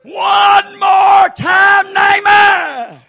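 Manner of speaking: high, loud, slow whoop; full-voiced cry of the refrain; shouted refrain. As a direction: ring it out across the church. Shouted refrain, then hushed echo.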